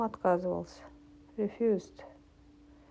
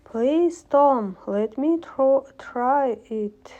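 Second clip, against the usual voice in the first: eager plea; 5 semitones higher, 6 dB louder, much slower than usual. Acted. Learner reading Ben's plea aloud.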